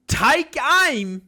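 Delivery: ordinary talk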